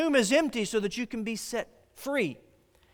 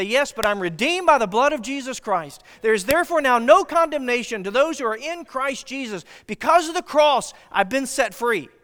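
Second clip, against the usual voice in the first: louder, higher preaching; full-force preaching; 4 semitones higher, 6 dB louder than usual. Acted. Preacher rousing the congregation, loud and high. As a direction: lift the congregation with the good news.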